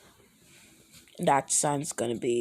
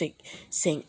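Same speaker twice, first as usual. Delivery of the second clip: ordinary talk, nothing marked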